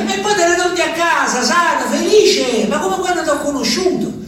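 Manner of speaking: Gemma imitata, voce molto più acuta, quasi cantilenata. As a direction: fai il verso a Gemma, acuta e insistente. (lui rifà la voce di Gemma che lo rassicura, acuta)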